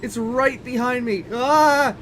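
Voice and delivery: high-pitched loud panic; screaming as it closes in; scared horror game streamer. Real, not acted. Red storm catching up.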